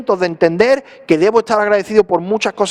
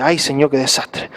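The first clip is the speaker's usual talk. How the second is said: a groaning complaint over the news; weary lament; 6 semitones lower, 3 dB quieter than usual. Retold or imitated. Reading the newspaper, he sighs a soft, low lament. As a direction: sigh it out, softer and lower. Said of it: "Y me ponía al día siguiente".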